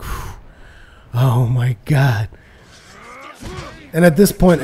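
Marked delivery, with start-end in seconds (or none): 0.0-2.3 slow relieved sigh. Retold or imitated. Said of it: "and I'm like".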